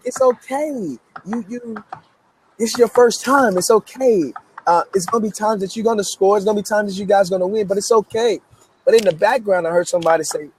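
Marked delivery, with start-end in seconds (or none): 0.0-8.4 soothing reassurance. Retold or imitated. Comforting cousin after loss.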